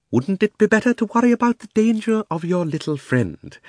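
high-pitched insinuating uncle; needling Uncle Andrew. Acted. Uncle reminds Diggory of Polly's danger, needling. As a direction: prod him pointedly, pitched high.

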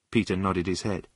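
ordinary delivery

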